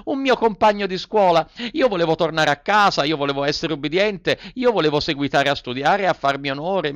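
lament, then swear revenge; Pinocchio, remorseful then angry. A narrator's reading. Pinocchio voiced high. (il burattino pentito, poi arrabbiato, voce acuta)

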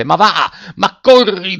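high, loud, drawn-out urging; desperate urging shout. A narrator's reading. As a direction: yell at the runner to keep moving. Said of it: "diceva il capitano stringendo i denti e i pugni".

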